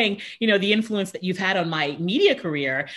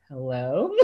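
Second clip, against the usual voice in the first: low, soft, put-on cool hello; fake calm hello; 9 semitones lower, 5 dB quieter than usual. Fan answering a star's call, in a low, soft, fake-calm voice. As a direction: act unbothered as you answer. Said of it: "Trying to act like I wasn't, like, freaking out".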